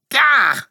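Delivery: high, loud "gah"; sudden high cry of annoyance; startled yelp. A narrator's reading. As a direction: yelp as if pounced on. Bob pounced on by the cat.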